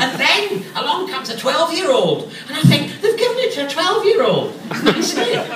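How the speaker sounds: high, loud comic indignation; hammed-up exasperation, voice climbing; mock outrage. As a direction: build to an incredulous high-pitched cry. Acted outrage at young casting.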